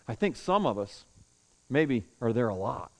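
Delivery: ordinary delivery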